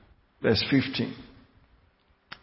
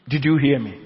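normal talking voice

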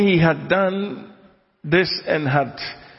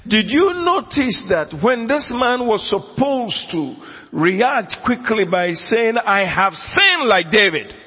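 much higher, raised full-force sermon; fiery preaching; 7 semitones higher than usual. Acted. Preacher challenging his congregation about Saul, at full force. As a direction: drive the question at the congregation.

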